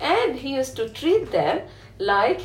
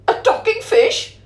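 high, loud astonished gasp of a question; king stunned by a talking fish; shocked king; 10 semitones higher, 5 dB louder than usual. Acted. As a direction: blurt it out in disbelief.

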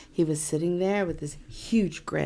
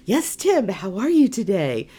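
flustered greeting; 5 dB louder than usual. Retold or imitated.

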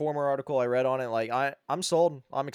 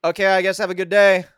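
higher, louder put-on clerk voice; deflated clerk; 6 semitones higher, 11 dB louder than usual. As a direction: send him off, a bit let down. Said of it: "he goes".

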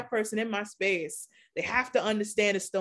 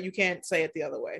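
ordinary talk, nothing marked

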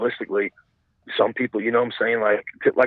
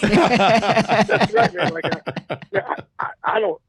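high, real laughter through the words; laughing agreement; 11 semitones higher, much slower than usual. Real, not acted. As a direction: agree while cracking up. Cracking up at redneck ingenuity joke.

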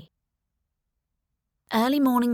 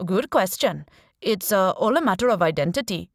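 put-on Indian accent; 4 dB louder than usual. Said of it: "he said in a strong Indian accent".

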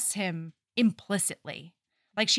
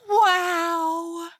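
swooning Jamie; 7 semitones higher, 4 dB louder, much slower than usual. Retold or imitated. Jamie swooning over Alec.

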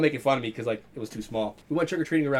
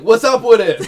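much higher, loud mock defiance; loud laughing retort; 9 semitones higher, 15 dB louder, slower than usual. Answering the teacher in his retelling, loud and laughing. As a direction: throw it back, laughing hard. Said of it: "I was like".

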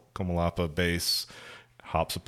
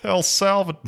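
much higher, louder hammed-up Latin American accent; good accent; 13 semitones higher, 8 dB louder, slower than usual. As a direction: play the officer with a big over-the-top Salvadoran accent. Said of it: "let me give him a good accent then".

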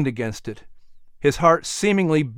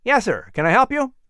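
put-on higher, eager young man's voice; a helpful workman greeting, pitched up; young worker; 9 semitones higher than usual.

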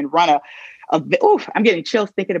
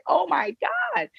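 retold despair; 13 semitones higher, 6 dB quieter, much slower than usual. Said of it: "I said".

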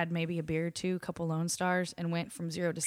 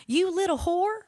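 higher, louder, sneering; mock drunk insult; 10 semitones higher, 5 dB louder, slower than usual. Bartender retelling the drunk's insult, higher and louder. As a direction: imitate the angry drunk. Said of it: "went immediately to, like, defense mode".

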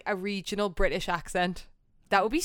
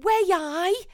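much higher, mocking fake Geordie; 12 semitones higher, much slower than usual. People mocking a Newcastle girl's accent, high and mocking. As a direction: squeal it like an outsider faking Geordie. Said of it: "They'll go".

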